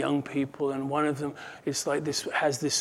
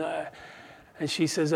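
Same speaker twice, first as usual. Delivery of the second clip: normal talking voice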